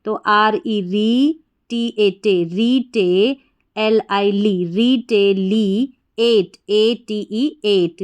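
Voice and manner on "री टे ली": ordinary talk, nothing marked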